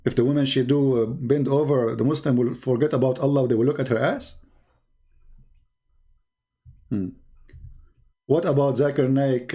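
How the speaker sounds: normal talking voice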